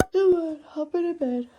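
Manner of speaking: high-pitched pet-talk voice; coaxing a pet. Real, not acted. Owner calling pet to hop in bed, coaxing.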